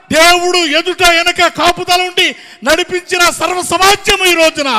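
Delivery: driving, mounting pulpit cry; full-force preaching. Real, not acted. Preacher recounting God's care in the wilderness, full-force preaching. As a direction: pile up each phrase with force.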